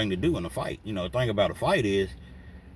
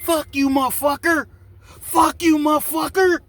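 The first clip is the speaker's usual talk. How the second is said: high, loud enraged yell; furious scream; 9 dB louder than usual. Retold or imitated. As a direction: scream it like he's finally snapped. Sean loses it, screaming.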